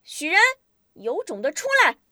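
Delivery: quick, high challenge called out; high-voiced shout. A narrator's reading. Fighter daring the hidden enemy to show himself, shouting. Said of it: "高声大喊".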